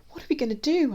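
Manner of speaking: high, loud, anxious; worried question. A narrator's reading. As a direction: ask it in rising worry. Worried child asks grandma.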